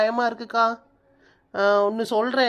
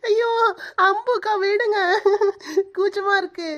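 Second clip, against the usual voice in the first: high, squealing giggle; giggling while tickled; 9 semitones higher than usual. Acted. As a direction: squirm and laugh through the words. Being tickled by older friend.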